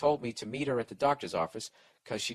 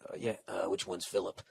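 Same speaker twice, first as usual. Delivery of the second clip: dazed clueless dad; 7 semitones lower, 7 dB quieter than usual. Retold or imitated. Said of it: "and you said".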